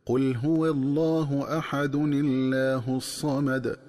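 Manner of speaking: قل هو الله أحد الله الصمد recited slowly with نون joined to الله; Quranic recitation. Shown as a demonstration. Teacher demonstrating the joined reading, slow recitation. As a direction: chant slowly, linking أحد into الله with a kasra nun. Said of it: "تكون القراءة هكذا".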